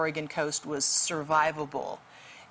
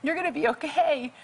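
retold scream; 9 semitones higher than usual. Rescuer calling to a freezing man in the water, high retold scream. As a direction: call out high-pitched reassurance to someone in danger. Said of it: "and I just screamed".

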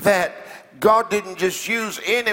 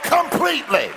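raised, exclaiming pulpit exhortation; rally the congregation to shout along; impassioned hallelujah preaching; fervent preaching; 4 semitones higher than usual. Real, not acted.

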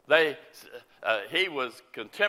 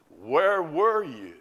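drawn out, louder cry to God; slow anguished plea; 3 semitones higher, 5 dB louder, slower than usual. Retold or imitated. Evangelist asking God about the dead baby, slow anguished cry. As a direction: ask it slowly, heartbroken.

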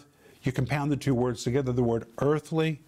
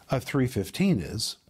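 ordinary delivery

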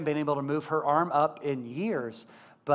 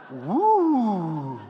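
long, swooping imitated "woo"; mock-astonished drawn-out whoop; amazed woo; 2 semitones higher, 4 dB louder than usual. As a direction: draw out a woo like a brand-new sensation. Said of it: "woo was a whole new experience for her".

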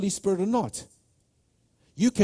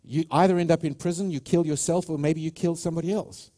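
ordinary delivery

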